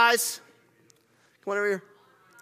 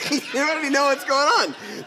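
laughing tease; 6 semitones higher than usual. Real, not acted. Director teases actor, laughing.

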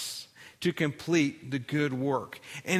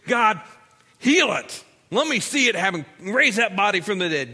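much higher and louder, urgent demands; demanding prayer; 6 semitones higher, 9 dB louder than usual. Retold or imitated. Scared believers demanding a miracle from God, loud and urgent. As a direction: insist that God act right now. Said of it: "when we're scared, we want God to act".

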